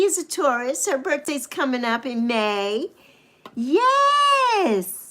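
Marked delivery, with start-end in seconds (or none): 3.5-5.0 long high sung-out yes; delighted high yes; high drawn-out yes. Real, not acted.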